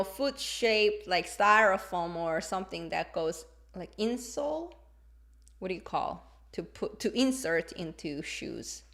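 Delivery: ordinary delivery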